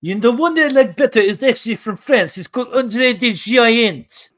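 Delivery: high, hammed-up French accent; mock French accent. Mock Frenchman preferring a wrestler from France, high French accent. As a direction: stretch the ending in a silly French accent.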